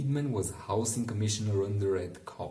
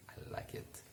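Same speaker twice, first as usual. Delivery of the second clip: breathy whispered aside; a conspiratorial whisper to the viewer; 13 dB quieter than usual. Real, not acted. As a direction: let it drop to a secret whisper.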